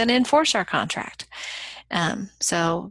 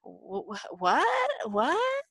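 stunned disbelief; 4 semitones higher, much slower than usual. Retold or imitated. Bob stunned by losing winnings.

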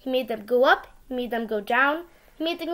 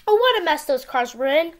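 ordinary delivery

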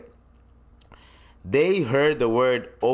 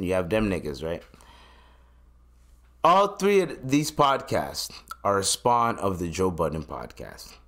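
ordinary delivery

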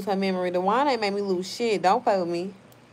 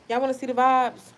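usual speaking voice

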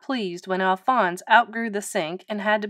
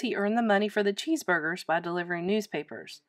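ordinary delivery, nothing marked

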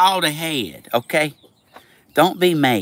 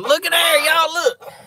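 excited; 14 semitones higher, 5 dB louder than usual. Real, not acted. Excited at eggs in box.

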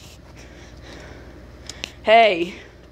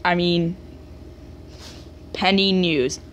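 ordinary talk

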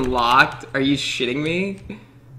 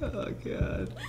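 breathless whispered gasp; hushed, spooked whisper; scared whisper; 6 semitones higher, 11 dB quieter than usual. Real, not acted. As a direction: barely breathe it out. Alone in a scary game.

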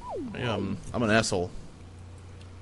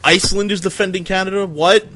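shocked surprise; 7 semitones higher, 7 dB louder than usual. Real, not acted. Betrayed by ally in game.

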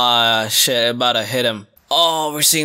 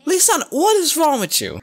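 high, incredulous outburst; can't believe what she just heard; stunned, rising protest; shocked disbelief; 11 semitones higher than usual. Real, not acted. Shocked by a child's line.

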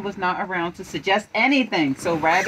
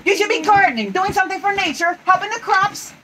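high, loud, fussy lecture; bossy Rabbit; 11 semitones higher, 5 dB louder, faster than usual. Acted. Rabbit lecturing Tigger about gardening, high and bossy. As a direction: tell him off in a high voice.

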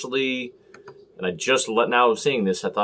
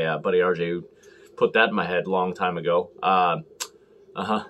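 ordinary talk, nothing marked

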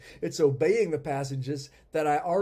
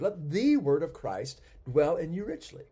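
"the" lifted and punched as "thee"; stress on the; 2 semitones higher, 4 dB louder than usual. Shown as a demonstration. Teacher repeating the verse for a particular word, stress on "the". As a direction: hit "the" hard, then carry the verse on. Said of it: "be the second word emphasized".

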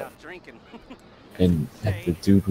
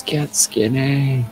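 high, drawn-out mock yell; slow, high-pitched quoted holler; imitated yell; 7 semitones higher, 4 dB louder, much slower than usual. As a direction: stretch it out high like a movie shout.

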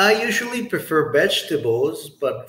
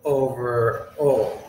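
slow and deliberate, each phrase set apart by pauses; slow model answer; much slower than usual. Shown as a demonstration. Teacher slowly models ranking chicken, fish and beef. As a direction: lay it out slowly with clear breaks. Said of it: "you can say".

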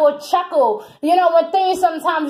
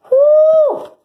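whew; 11 semitones higher, 6 dB louder than usual. Real, not acted. Emotional channelled outpouring.

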